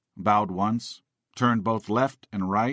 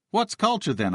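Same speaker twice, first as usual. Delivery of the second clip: retold yell; 11 semitones higher than usual. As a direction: fire it back at the editor. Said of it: "I yelled".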